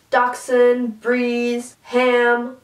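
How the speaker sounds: normal talking voice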